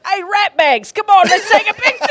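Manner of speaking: high, loud mock-Aussie dad shout; Australian accent. Australian dad rounding up his kids for a photo, shouted in imitated Australian accent. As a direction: holler like a cheery Australian bloke. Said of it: "I'm doing a terrible job here".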